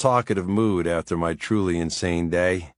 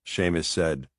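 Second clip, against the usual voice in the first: plain reading voice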